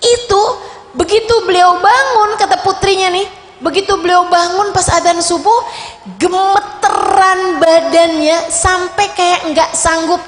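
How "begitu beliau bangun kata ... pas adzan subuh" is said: normal talking voice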